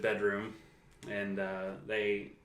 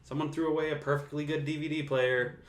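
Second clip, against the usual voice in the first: imitating Peter; 6 semitones higher than usual. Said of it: "And he's like".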